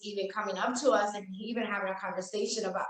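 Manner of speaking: ordinary talk, nothing marked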